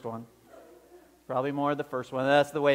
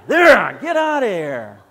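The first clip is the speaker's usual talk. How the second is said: louder, irritated send-off; grumpy shout; 2 semitones higher, 12 dB louder, slower than usual. Retold or imitated. Friend throws bread at the caller, annoyed loud dismissal. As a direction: throw it and get rid of him.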